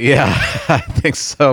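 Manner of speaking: can't keep a straight face; laughing. Real, not acted. Cohost laughing at the intro.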